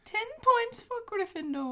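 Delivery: high put-on professor voice; wizard teacher voice. Quoting a Hogwarts teacher.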